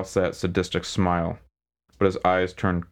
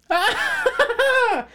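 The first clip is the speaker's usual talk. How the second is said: shrill gloating squeal of a laugh; high-pitched squealing laugh; 4 dB louder than usual. Acted. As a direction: let out a squealing villain cackle. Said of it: "high-pitched squeal masquerading as a laugh".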